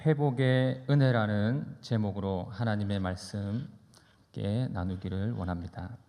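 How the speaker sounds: ordinary delivery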